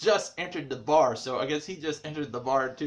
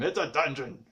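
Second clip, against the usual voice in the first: ordinary delivery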